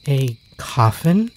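lightly voiced child, questioning rise; child repeating. Child repeats woodcutter's word, lightly high question. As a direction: echo the word like a curious child.

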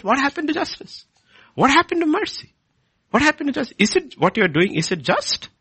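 high-pitched acted challenge; indignant questioning. Acted challenge in Nehemiah's words.

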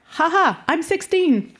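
high, giggly reply; high, amused teen voice; giggly teenager. Acted. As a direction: answer with a high, girlish laugh. Acts her younger self answering Colonel.

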